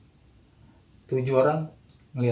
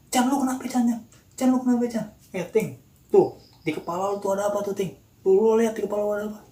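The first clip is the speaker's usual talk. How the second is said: high, raised voice, acting out alarm; urgent calling out; 8 semitones higher, 4 dB louder than usual. Storyteller replaying Nano calling Chan and Ting, urgent high calls. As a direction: call to friends urgently, as re-enacted.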